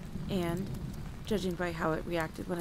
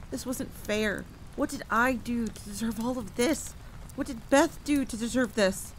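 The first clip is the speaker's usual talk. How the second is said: louder, higher, despairing protest; anguished outcry; 5 semitones higher, 7 dB louder than usual. A narrator's reading. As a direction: cry out in grief and outrage. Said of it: "I cried until I was screaming".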